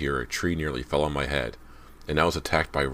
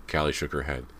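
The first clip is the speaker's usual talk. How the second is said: ordinary delivery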